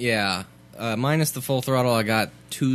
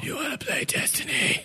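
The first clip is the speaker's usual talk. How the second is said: high strained voice; 3 dB quieter than usual. Pretend. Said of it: "You're gonna break your voice".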